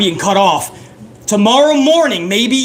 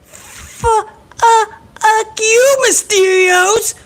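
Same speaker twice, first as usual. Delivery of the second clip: very high falsetto, stuck on the first syllable; hammed-up high-pitched sputtering comeback; squeaky mock stutter; 12 semitones higher, much slower than usual. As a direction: stammer the retort in a silly squeal. Quarrel escalates into stuttering squeal.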